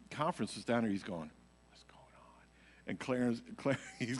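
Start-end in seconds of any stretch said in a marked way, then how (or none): 1.7-2.4 hushed, breathy whisper; whispered question. Retold or imitated. Conference leader asking beside the stage, whispered. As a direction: lean in and whisper it.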